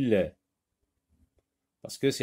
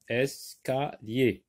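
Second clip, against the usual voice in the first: "Escalier" slowly as es, ca, lier; split into syllables; slower than usual. Shown as a demonstration. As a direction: pause after each of the three syllables of "Escalier". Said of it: "Trois syllabes".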